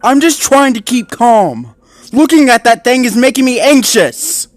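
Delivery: exasperated and on edge; annoyed. Acted. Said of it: "voice filled with annoyance".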